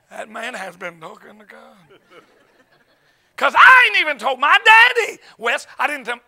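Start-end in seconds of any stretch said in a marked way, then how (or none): 0.1-1.8 very soft, measured repeat with full HAS; hushed comic conviction; mock solemn awe. Repeats the reaction with more weight. 3.4-5.2 high, loud preacher's exclamation; loud protest climbing on DADDY; amazed outburst. Real, not acted. Exclaims his amazement to congregation.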